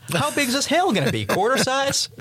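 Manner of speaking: high-pitched mock alarm; panicking friend. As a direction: play the scared passenger begging to know. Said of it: "starts to panic and says".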